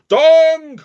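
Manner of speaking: long high loud bong; clock chime. A narrator's reading. Clock striking midnight, a long loud bong. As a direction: ring it out like a big clock bell.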